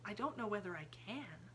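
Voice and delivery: soft half-whisper; Sarah asks Miss Amelia in a hush. A narrator's reading. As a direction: keep it hushed and calm. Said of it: "still in her half-whisper".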